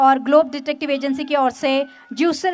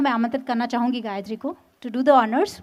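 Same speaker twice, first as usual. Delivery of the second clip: ordinary delivery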